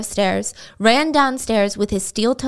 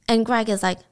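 ordinary talk, nothing marked

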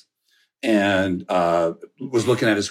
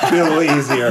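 laughing; 4 semitones higher, 4 dB louder, faster than usual. Real, not acted. Laughing at visual aid joke.

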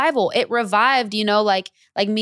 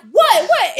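much higher and louder, stunned double take; shocked disbelief; 12 semitones higher, 10 dB louder than usual. Retold or imitated. Seeing Lisa dance to his song, yelping in shock. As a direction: yelp it twice, can't believe it.

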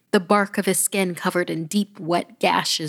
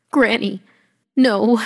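loud, higher croaking plea; croaked cry; 4 semitones higher, 8 dB louder than usual. Acted. Terrified child crying out to stop granny, croaked and loud. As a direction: cry out hoarse with fright. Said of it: "I croaked".

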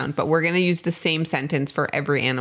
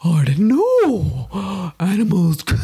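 loud, very slow, wavering creature; drawn-out underwater monster voice; octopus voice; 3 semitones higher, 5 dB louder, much slower than usual. Pretend. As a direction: boom it out like a wizardly octopus. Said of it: "what would an octopus sound like?".

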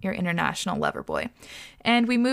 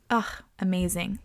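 ordinary talk

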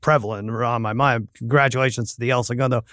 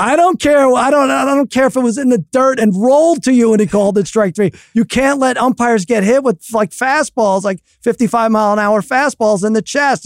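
higher, louder stern telling off; scolding dad; 9 semitones higher, 4 dB louder than usual. Retold or imitated. Dad scolding his son in the car, loud and stern. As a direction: lay down the law to a cheeky kid.

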